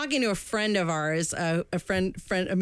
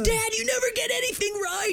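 put-on much higher, bratty kid whine; mock tantrum; 14 semitones higher than usual. Hosts replaying the son's outburst at dad, high bratty whine. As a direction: whine it like a spoiled boy.

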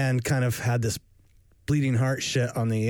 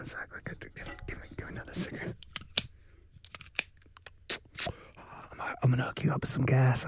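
whisper; 7 dB quieter, faster than usual. Pretend. Thief whisper.

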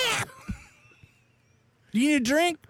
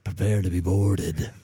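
sexy voice; 7 semitones lower than usual. Acted. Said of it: "Should I do it in a sexy voice?".

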